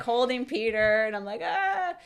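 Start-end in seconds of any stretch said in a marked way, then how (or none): 1.4-1.9 short high startled cry, pitched way up; a sudden yelp of alarm, half-retold; flustered yelp. Holding a slippery crying baby.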